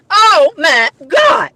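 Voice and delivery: very slow, drawn-out exclamation; appalled. Real, not acted. Woman appalled at mover's behavior, drawn-out dismay. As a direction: stretch each word out, aghast.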